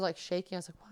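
ordinary talk

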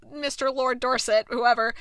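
usual speaking voice

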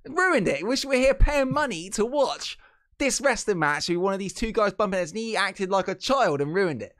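high-pitched indignant wrestling-nerd rant; genuinely annoyed fan ranting; nerdy rant. Real, not acted. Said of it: "I sound like the wrestling nerd voice I do".

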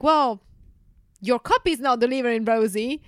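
annoyed client complaint. Retold or imitated. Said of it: "they're like".

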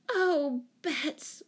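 high, dismayed wail; drawn-out, exasperated lament. A narrator's reading. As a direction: wail the name in despair. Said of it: "wailed Jane".

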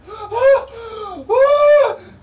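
loud frightened gasp; terrified high gasp. Acted. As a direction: gasp as if seeing a monster. Terror as she sees Strahd.

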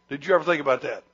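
usual speaking voice

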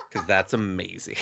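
ordinary delivery

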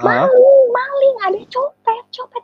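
high-pitched mock cry of alarm; shrill-pitched warning call at talking volume; retold thief alarm. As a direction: imitate someone calling out about a pickpocket. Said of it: "tiba-tiba tuh ada yang teriak".

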